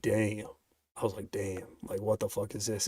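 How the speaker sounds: soft, awed murmur of disbelief; hushed awe. Retold or imitated. Retelling being stunned by a song, hushed awe. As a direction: breathe it out, stunned by the music.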